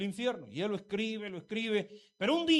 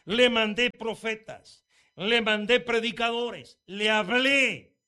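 lento y mucho más fuerte, recalcando cada le mandé; lamento de Dios; 3 semitones higher, 10 dB louder, slower than usual. Retold or imitated. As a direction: Dios reprochando con voz fuerte y pausada. (reproche divino, lento y fuerte)

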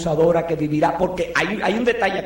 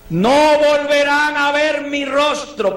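slow, higher and louder proclamation; solemn slow farewell; 6 semitones higher, 4 dB louder, much slower than usual. Retold or imitated. Paul telling the brothers at Miletus, slow and solemn. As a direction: stretch each word out like a final goodbye. Paul's farewell words quoted.

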